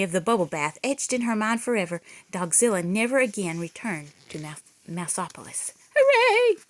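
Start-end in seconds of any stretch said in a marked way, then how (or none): 6.0-6.6 high, loud cheer. Acted. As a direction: cheer the victory. Mice celebrate victory.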